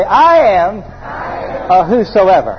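drawn-out words for a crowd to repeat; slow leading call. Shown as a demonstration. Preacher having everybody declare it, slow and drawn out. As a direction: lead them slowly, stretching "I am". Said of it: "Everybody say".

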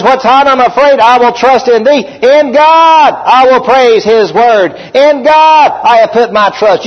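high and strong, slowing on "In God I will praise his word"; preaching scripture. Acted. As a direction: proclaim the verse to the church.

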